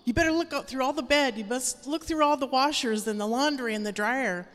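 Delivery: lightly voiced sneering tempter; devil's taunting voice. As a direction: tease her like a sly tempter. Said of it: "devil starts attacking me".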